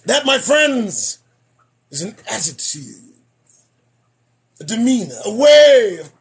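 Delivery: slow sermon build then drop; preaching. Acted. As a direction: preach the warning slowly. Mock sermon to viewers.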